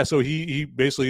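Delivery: usual speaking voice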